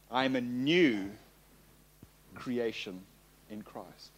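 "new" stretched out, then a pause; stress on NEW. Shown as a demonstration. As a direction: linger on "new", softly drop the rest. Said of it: "put the emphasis on a different word".

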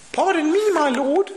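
very high squeak with a laugh; squeaky Gideon. Acted. Gideon replying to the angel again, squeaky. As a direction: squeak like a terrified mouse.

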